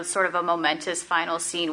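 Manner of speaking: usual speaking voice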